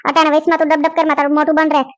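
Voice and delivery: usual speaking voice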